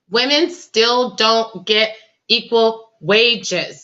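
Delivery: very slow, word by word; emphatic. Real, not acted. Host insisting women still lack equal pay, slow and emphatic. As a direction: space out every word to drive it home.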